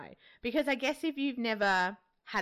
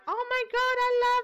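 high, squealing mock gush; mock-gushing mum squealing at the midwife; 5 dB louder, faster than usual. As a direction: imitate an overexcited mum, pitched way up.

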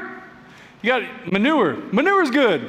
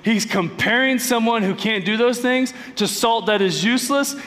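ordinary talk, nothing marked

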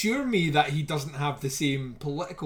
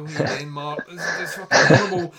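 exasperated; 7 dB louder than usual. Retold or imitated. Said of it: "I'm like".

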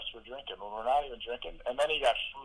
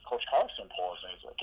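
usual speaking voice

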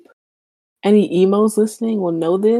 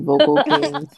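laughing; 9 semitones higher, slower than usual. Real, not acted. Joking praise of the band.

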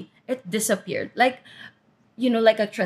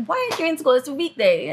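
high-pitched retold telling-off; mad scolding; 6 semitones higher, faster than usual. Grandma scolds dad found at home, retold mad scolding. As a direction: imitate an exasperated grandma. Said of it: "she was so mad".

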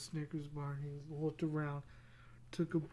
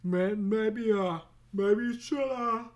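hyper cartoon squirrel; 6 semitones higher, 8 dB louder, slower than usual. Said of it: "where he was like".

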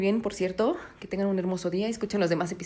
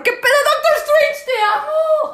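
much higher, loud, crying shriek of love; lovestruck fangirl shriek; 8 dB louder, slower than usual. Real, not acted. Fan shrieking her love for Doctor Strange. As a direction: scream out your obsession. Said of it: "así sueno yo de enamorada, de loca".

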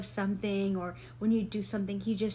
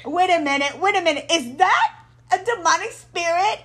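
higher and louder, hammed-up alarm; mock alarm; 7 semitones higher, 9 dB louder than usual. Suspecting the owl is a demon, hammed-up alarm. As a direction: ham up spotting a demon. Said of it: "and I was like".

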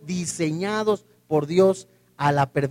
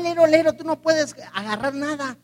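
put-on childish taunt; 7 semitones higher than usual. Childish taunt, hand to eye.

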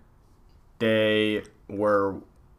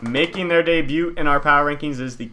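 top ten countdown; 5 semitones higher, 6 dB louder than usual. Acted. Announces power rankings countdown.

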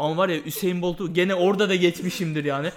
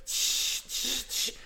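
soft unvoiced tsh tsh tsh; shushing hiss; 5 semitones higher, 7 dB quieter, much slower than usual. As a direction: hiss quietly like a running tap. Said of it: "böyle bir ses çıkartıyorum".

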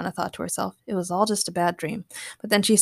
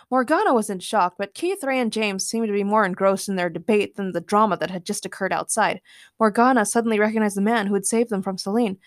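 usual speaking voice

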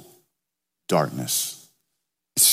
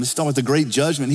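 ordinary delivery

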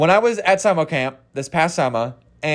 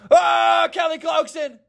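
sudden high yell, then the name squeezed out tight; Steve Carell shout; 11 semitones higher than usual. Retold or imitated. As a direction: blurt it like a man startled mid-scene. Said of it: "take a look at Steve Carell".

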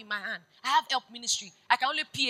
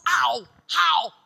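shouted question; 2 semitones higher, 8 dB louder than usual. Real, not acted. Preacher challenging the congregation.

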